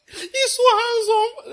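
high, slow, gushing grandmother voice; doting grandmother. Retold or imitated. Mother gushing over her grandson, high and slow. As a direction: coo over the baby like a proud grandma. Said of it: "He said".

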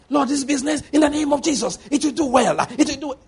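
high, far-off mock shouted prayer; mock shouting prayer. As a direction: lampoon a believer shouting his prayer. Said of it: "Stop shouting when you're alone".

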